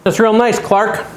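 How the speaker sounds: talking through a mouthful; chewing while speaking; mouth full. Real, not acted. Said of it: "Talking with my mouth full".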